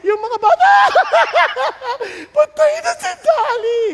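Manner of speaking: high, loud laughing shout; laughing taunt. Retold or imitated. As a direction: cackle and jeer at the dead lamb. Children mocking, lamb is dead. (tumatawang pang-aasar)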